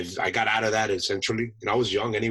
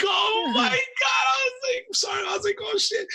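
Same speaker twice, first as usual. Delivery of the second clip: mock panic. Young Marine rebuked by his sergeant major, mock panic. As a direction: squeal it in exaggerated panic. Panicked reaction to intimidating superior.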